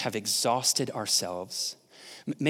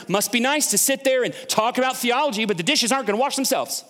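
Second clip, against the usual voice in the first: 1 passive-aggressive; 11 semitones higher, 4 dB louder than usual. Acted. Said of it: "a little bit of passive-aggressive tone in her".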